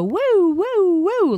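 high wailing siren woo; siren noise. Retold or imitated. Imitating the hat's siren for the listener. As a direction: mimic a spinning hat light. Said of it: "it would go".

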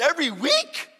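"Every week?" high, loud, rising; incredulous question. Retold or imitated. Newcomer double-checks with friend, incredulous. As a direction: ask in loud, high disbelief. Retold disbelief at friend's answer.

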